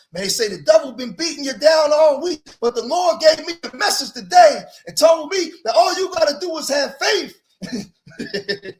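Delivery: slowed, stretched preacher's cry on the last words; mock preacher. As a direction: hammer it out like a Sunday preacher. Mock sermon shout.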